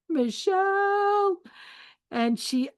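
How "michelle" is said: a high, slow, drawn-out shout of the name; retold scream. Mother calls out her daughter's name on seeing the tattoo, shocked shout. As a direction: call her name in shock, stretched long. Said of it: "and I screamed".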